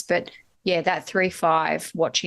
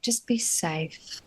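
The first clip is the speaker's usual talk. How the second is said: softer, slow advice; 6 dB quieter, slower than usual. Retold or imitated. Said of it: "I say that to her all the time".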